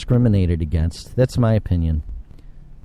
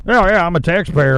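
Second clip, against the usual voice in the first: high, mocking taxpayer imitation; pushy, mocking complaint; mock entitlement; 7 semitones higher, 3 dB louder than usual. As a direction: mimic a self-important taxpayer. Mimics complaining taxpayer to cop.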